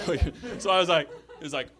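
ordinary talk, nothing marked

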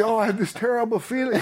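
high, quick, giggling; nervous laughing admission; laughing confession. Retold or imitated.

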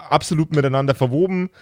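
usual speaking voice

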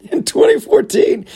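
high laugh-cracked repeat; giggling while saying the year. Real, not acted. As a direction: laugh through the words. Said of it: "I don't know why I keep laughing".

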